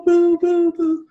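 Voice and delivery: high, fast electronic beeping; heart monitor beeps. Retold or imitated. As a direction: mimic a monitor speeding up.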